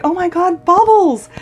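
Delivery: high, loud delighted cry; joyful squeal. Retold or imitated. Spotting the family Shih Tzu, a high joyful squeal. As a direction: burst out with joy at the dog. Greeting her dead dog.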